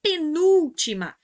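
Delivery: penúltima slow and loud, NÚ high; stress on penultimate. Shown as a demonstration. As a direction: stretch and punch the NÚ of penúltima. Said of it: "isso aqui é regra da paroxítona".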